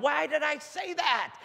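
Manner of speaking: high, drawn-out wail of regret; exasperated self-reproach. Retold or imitated. Preacher kicking himself on the drive down, exasperated wail. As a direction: wail it at yourself in mock despair. Berating himself after risky prophecy.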